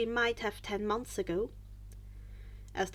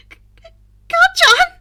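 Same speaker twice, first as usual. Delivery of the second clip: high, loud stammering cry of fright; frightened squeak; 20 dB louder than usual. A narrator's reading. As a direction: squeak his name out in panic. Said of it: "Izuku squeaked".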